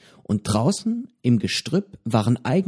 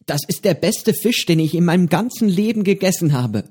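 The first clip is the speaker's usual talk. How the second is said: feierlich; 7 semitones higher than usual. A narrator's reading. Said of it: "sagte Petro feierlich".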